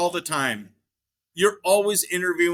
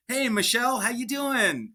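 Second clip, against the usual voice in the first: bright casual greeting; 7 semitones higher than usual. Retold or imitated. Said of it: "answer the phone and say".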